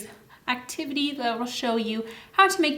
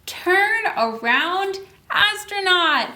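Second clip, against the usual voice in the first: higher calling out to the astronaut, slow; 7 semitones higher, 3 dB louder, slower than usual. Shown as a demonstration. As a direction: call to the astronaut to turn around.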